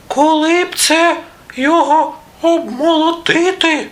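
very high squeaky mouse voice; little mice. A narrator's reading. Mice look at the wheat ear, squeaky voice. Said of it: "Поприбігали мишенята та й кажуть".

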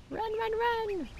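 very high, slow squeaky cheering; playful bird voice. Pretend. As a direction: egg the little birds on in a squeaky voice. Cheering on running birds.

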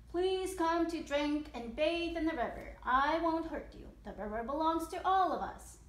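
announced invitation. Acted. Reformed crocodile invites all animals, calling out. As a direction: call out an invitation to everyone.

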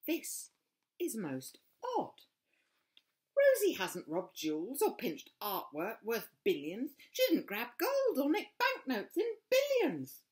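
high, loud, amused dog voice; puzzled police dog. A narrator's reading. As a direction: report the odd finding, half laughing. Sniffer dogs radio back.

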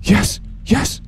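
loud high triumphant yells; excited shouting. Real, not acted. Reaching the goal.

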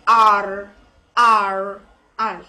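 American "R" with the final r sounded; long American-accented "R". Shown as a demonstration. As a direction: curl the tongue into "R", American style. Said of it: "in case of American accent".

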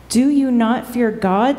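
normal talking voice

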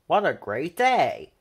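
ordinary delivery, nothing marked

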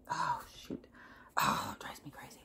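hushed, whispered aside to oneself; frustrated mutter. Real, not acted. Speaker groping for a lost word, frustrated whisper. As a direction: mutter it under the breath, exasperated.

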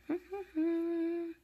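high little giggle; chuckle. Real, not acted. Amused by playing kittens, high chuckle.

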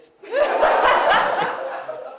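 high, loud cackling laugh; loud burst of laughter. Real, not acted. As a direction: laugh out hard at the joke. Laughing at own punchline.

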